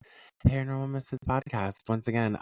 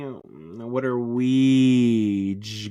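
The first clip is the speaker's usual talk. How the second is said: drawn-out teasing; 5 dB louder, much slower than usual. Acted. Stretched teasing.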